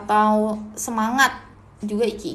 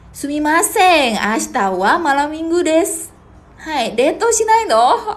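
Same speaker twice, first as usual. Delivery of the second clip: slow, higher, louder playful Japanese; playful teasing; 5 semitones higher, 6 dB louder, slower than usual. Acted. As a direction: tease the class in slow exaggerated Japanese. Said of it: "teasing students in Japanese". (menggoda murid dengan nada main-main)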